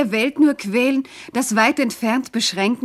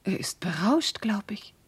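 soft, hushed aside; dreamy whisper; 7 dB quieter than usual. Acted. Alkmene musing about her lover, quietly whispered aside. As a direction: whisper it to yourself, half-dazed.

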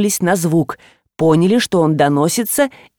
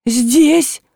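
mildly tearful, higher-pitched cry; understated whimpering exclamation; sobbing; 5 semitones higher than usual. A narrator's reading. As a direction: answer with a touch of a sob. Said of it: "всхлипнула Галина".

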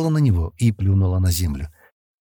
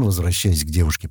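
plain reading voice

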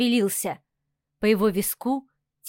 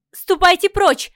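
loud, high, outraged shout; 7 semitones higher, 6 dB louder, slower than usual. A narrator's reading. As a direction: yell at him to back off. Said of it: "выкрикнула я".

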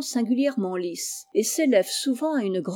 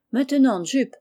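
calling out; 3 semitones higher, 4 dB louder than usual. Acted. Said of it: "cria le grand".

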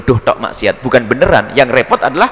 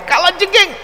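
sharply higher warning cry; alarmed cry; slower than usual. Retold or imitated. A friend warns of a scorpion near his neck, high alarmed cry. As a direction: yelp the warning as if danger is at the neck. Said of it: "sambil teriak ngomong".

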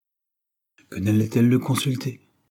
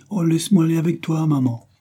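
put-on high, louder childlike plea; child pleading; 8 semitones higher, 5 dB louder than usual. A narrator's reading. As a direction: beg mama eagerly, pitched up. Child begs to come along.

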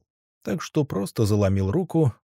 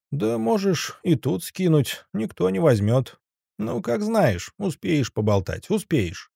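gruff offhand man; 8 semitones higher than usual. Acted. Zayats voiced, offhand dismissive.

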